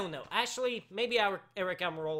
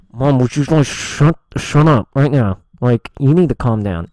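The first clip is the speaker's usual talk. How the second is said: very loud, lower-pitched outburst; angry bellow; 5 semitones lower, 23 dB louder than usual. Real, not acted. Host ordering someone to shut up, angry bellow. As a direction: bellow it right into the mic.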